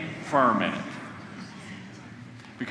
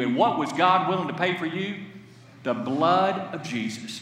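ordinary delivery

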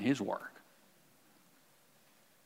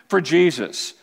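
ordinary talk, nothing marked

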